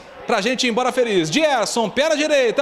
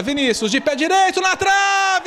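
excited shout; 2 semitones higher than usual. Real, not acted. Penalty hits the crossbar.

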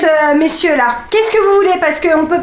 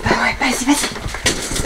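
whispering while hiding; 6 semitones lower, 6 dB quieter, slower than usual. Real, not acted.

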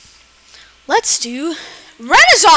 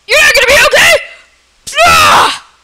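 high, loud hammed-up shout; mock threat; 13 semitones higher, 7 dB louder than usual. Gamer threatening a game kitty, hammed-up shout.

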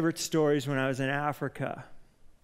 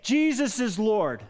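yelled command; 5 semitones higher, slower than usual. Retold or imitated. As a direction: demand 'Jesus is Lord' at full force. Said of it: "They like to yell".

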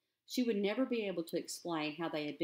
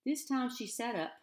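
usual speaking voice

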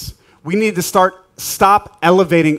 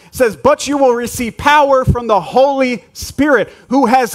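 full-force preaching; 7 semitones higher than usual. Acted. Pastor drives home the point to the church, full-force preaching. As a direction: preach it hard to the congregation.

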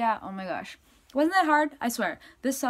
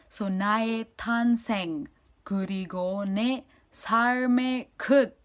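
usual speaking voice

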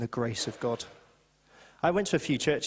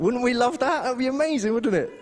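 high, loud and eager; rising excitement, higher and louder; excited; 9 semitones higher, 5 dB louder than usual. Real, not acted. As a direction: sell the dream to the room. Preacher enthusing to congregation.

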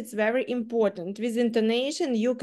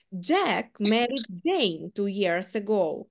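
Jack stressed, slow and falling; slower than usual. Shown as a demonstration. Teacher shows emphasising that Jack married. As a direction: make it clear it was Jack. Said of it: "я хочу сказати, що Джек одружився".

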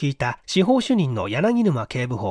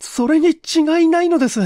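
much higher, louder shaky voice; trembling insistence; 14 semitones higher, 5 dB louder than usual. A narrator's reading. Okamoto cutting in to the inspector, trembling with fear. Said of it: "震えながら口を出した".

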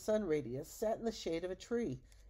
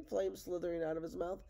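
usual speaking voice

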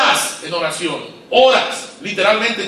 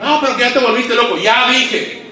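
full-force preaching; 5 semitones higher than usual. Acted. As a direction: preach it out to the pastors.

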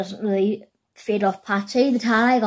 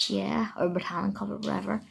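whispering; 10 dB quieter than usual. Real, not acted. Whispered aside.

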